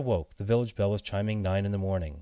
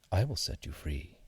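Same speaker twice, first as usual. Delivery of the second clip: soft reassuring promise; 2 semitones lower, 3 dB quieter than usual. Acted. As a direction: reassure the frightened seed. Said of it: "the cat said softly".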